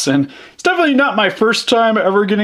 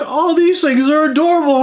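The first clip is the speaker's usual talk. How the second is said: cooing crew member; 10 semitones higher than usual. Retold or imitated. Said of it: "everybody on the ship is just like".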